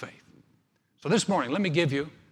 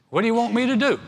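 raised, high-pitched question; acted emphatic question; 6 semitones higher, 6 dB louder, faster than usual. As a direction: put it loudly and high. Voices Jesus's question, preaching emphatically.

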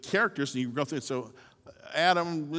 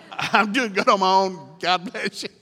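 laughing plea; 4 semitones higher, 9 dB louder than usual. Pretend. Preacher joking prayer to God.

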